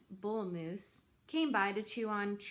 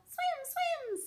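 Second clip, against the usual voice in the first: very high little gosling saying a name; high, small baby goose; tiny gosling voice. A narrator's reading. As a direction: chirp it out like a baby bird. Gosling excited to swim.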